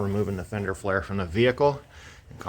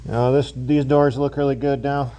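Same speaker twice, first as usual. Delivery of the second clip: louder and higher through a respirator; through a face mask; 7 semitones higher, 4 dB louder than usual. Real, not acted. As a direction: talk through a paint mask. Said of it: "Hopefully you can hear me through my mask".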